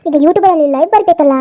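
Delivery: ordinary talk, nothing marked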